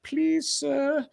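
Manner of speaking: very high, squeaky plea; hammed-up pitiful peasant; mock shocked begging. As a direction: beg again in disbelief.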